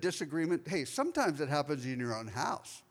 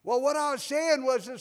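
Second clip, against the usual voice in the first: mock louder repeat, higher; an American tourist hamming it up louder; 8 semitones higher, 5 dB louder than usual. Mimicking a tourist repeating louder to a foreigner. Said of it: "they say it louder".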